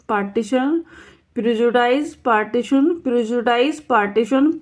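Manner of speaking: usual speaking voice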